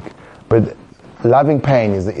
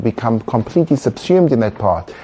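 ordinary talk

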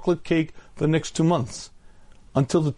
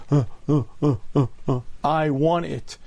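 animal craving voice; 2 semitones lower than usual. Pretend. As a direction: grunt like an animal soul wanting its treat. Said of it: "a nefesh bahami that says".

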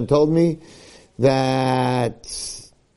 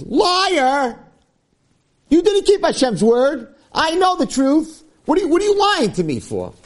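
high, drawn-out mock accusation; hammed-up outraged confrontation, pitched way up; slow, high-pitched acted scolding; accusing rebuke; 14 semitones higher than usual. Mock rebuke Shmuel should have given.

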